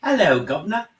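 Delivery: loud, high mock cockney hello; hammed-up cockney accent. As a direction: bellow a hammed-up cockney greeting.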